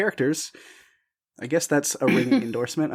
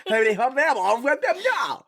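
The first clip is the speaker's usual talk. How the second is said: imitated high gibberish with a French lilt; French Simlish; 10 semitones higher than usual. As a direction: mimic your own mock-French babble. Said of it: "I was like".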